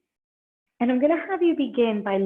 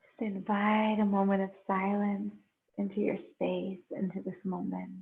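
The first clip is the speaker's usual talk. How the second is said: slow, unhurried meditation guide with long pauses; guided meditation. Real, not acted. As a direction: ease them into breath and stillness, leaving space between phrases.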